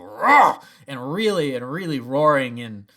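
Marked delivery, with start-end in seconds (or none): 0.0-0.6 loud roar; mock roar. Fan imitating Vader ripping the ship apart, loud roar. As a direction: roar with effort and anger. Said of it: "you can hear him go".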